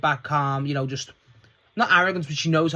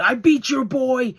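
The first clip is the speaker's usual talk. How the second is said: mock heel taunt; 11 semitones higher, 7 dB louder, slower than usual. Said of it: "He goes".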